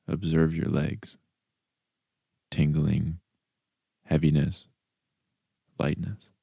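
calm body-scan voice with long pauses; guided meditation. Acted. Host leads listeners through a body scan, calm meditation voice. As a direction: guide them softly and unhurriedly through the body.

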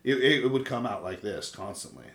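just talk, dropped low and easy; slowed-down deep voice. Shown as a demonstration. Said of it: "if I were to just slow down and just talk".

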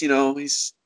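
ordinary talk, nothing marked

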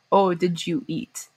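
slow, spelled-out Oh, did you eat; slow demo. Shown as a demonstration. Demonstrating the full phrase slowly. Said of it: "it was like".

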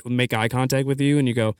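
ordinary talk, nothing marked

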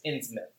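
Innsmouth said as "INNZ-mith"; accepted pronunciation. Shown as a demonstration. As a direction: end Innsmouth on a short "mith". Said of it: "the accepted pronunciation is".

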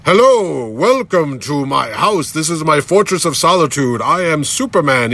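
hammed-up superhero welcome, drawn out; imagined grand Superman. Ordway welcomes visitor to his Fortress of Solitude, mock grand. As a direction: announce yourself like a comic-book hero. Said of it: "I always picture, like".

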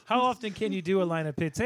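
usual speaking voice

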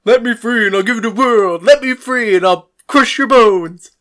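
loud, high mock of a ranting father; mocking imitation. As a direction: parrot his threats back at full volume. Mocking quote of father's repeated threats.

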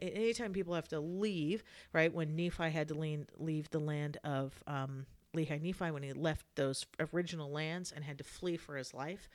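usual speaking voice

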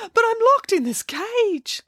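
very high, drawn-out whine; tearful wail. Acted. Crying puppet explains his cage to fairy, tearful wail. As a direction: wail it slowly and pitifully. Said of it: "Why are you crying".

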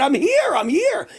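high-pitched mock yell; retold scream. Host re-enacts answering from the back of the room, calling out high. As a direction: call out to be found across a hall. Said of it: "I screamed from the back of the room".

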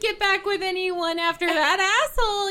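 high, loud mock gushing; gushing parents. Parents congratulate daughter on new boyfriend, hammed-up gushing. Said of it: "and they're like".

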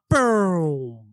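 long, falling "boom" noise; imitated stereo effect. Describing the film's sound gag to co-hosts, imitated stereo boom. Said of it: "you hear, like, a stereo".

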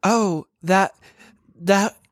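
shop assistant being helpful; helpful store clerk voice; customer service voice. Pretend. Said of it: "our best customer service voice".

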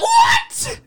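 very high, loud laughing yelp; shocked disbelief. Real, not acted. [name] hearing his cake was stolen, laughing high yell.

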